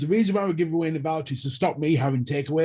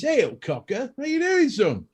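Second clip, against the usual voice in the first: Yorkshire greeting; 8 semitones higher than usual. Shown as a demonstration. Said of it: "The proper saying is".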